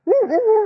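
high, loud imitated barking; dog barking. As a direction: bark like the set-on dog.

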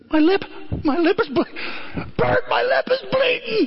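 high, overjoyed cry; a shout of wonder at the blood; joyful disbelief. Retold or imitated. George thrilled his lip is bleeding, high joyful cry.

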